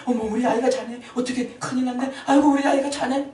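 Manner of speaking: high, loud mock panic over a sleeping child; panicked parent. Preacher mocks a parent panicking at a sleeping child, high and loud. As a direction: ham up a parent gasping in alarm. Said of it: "이러는 부모 있나요".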